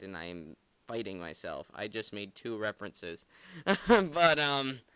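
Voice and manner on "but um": laughing. Real, not acted. Laughs after making two references.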